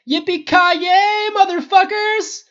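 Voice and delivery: whooping battle cry. Acted. The archer airborne firing at spiders, whooping yell. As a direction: holler it as you fly.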